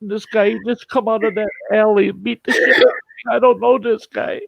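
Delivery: high, mock-weepy whine; badly faked crying to fool a cop; fake tears. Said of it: "Fake tears, fake tears all of a sudden".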